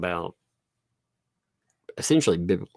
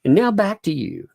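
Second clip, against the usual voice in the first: higher, mock correspondent sign-off; cute correspondent; 9 semitones higher, 4 dB louder than usual. Shown as a demonstration. Parent modeling correspondent line for kid, higher cutesy sign-off. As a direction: hand back like a cute reporter. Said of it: "Can you be like".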